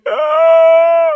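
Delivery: loud, high, drawn-out mock cry; mock sobbing wail. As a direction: wail as if crushed by the movie. Acts out being crushed by film.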